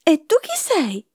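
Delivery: high, drawn-out exclamation; storybook cry. A narrator's reading. Three bears confront the sleeping girl, high mock cry.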